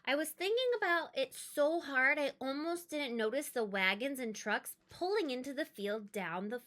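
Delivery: usual speaking voice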